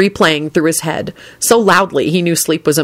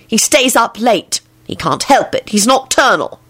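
higher, insistent defence; threatening; 6 semitones higher than usual. A narrator's reading. Elizabeth insisting he can't help it, threatening. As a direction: defend him with a warning edge. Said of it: "Elizabeth said threateningly".

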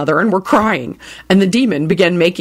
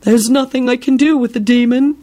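moaning; 5 semitones higher than usual. Acted. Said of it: "Binns moaned".

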